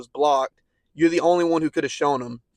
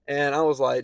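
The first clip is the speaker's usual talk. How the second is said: ordinary delivery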